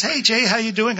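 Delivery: high, loud barista hello; imitated cheery greeting. Barista greets a regular customer, imitated as high and cheery. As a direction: call out a lively greeting in her voice.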